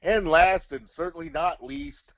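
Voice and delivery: ordinary talk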